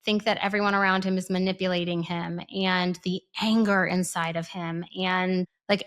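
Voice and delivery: ordinary talk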